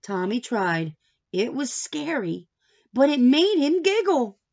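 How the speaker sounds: ordinary delivery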